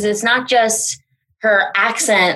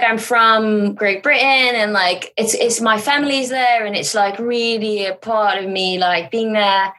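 put-on British accent; casual chatter in an imitated British accent. Co-host mocking a fake claim to be from Britain, British accent. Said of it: "when you're doing the accent".

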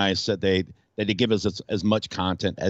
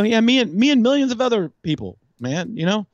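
much higher, louder excited comeback; emphatic retort; 12 semitones higher, 4 dB louder than usual. Retold or imitated. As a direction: fire it back at her, pumped and loud. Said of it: "I go".

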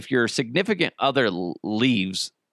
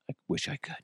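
wistful murmur; 4 semitones higher, 12 dB quieter, much faster than usual. Acted. Wistful echo after answer.

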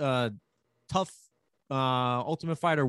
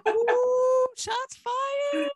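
far higher falsetto, teasing; mock shocked squeal; much slower than usual. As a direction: squeal it like a gossip hearing a diss. Teasing jab at Jersey.

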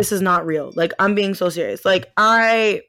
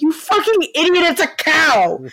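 high, loud exasperated scolding; mock scolding; 9 semitones higher, 5 dB louder than usual. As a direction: scold the fool like an irritated grandma. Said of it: "She's like".

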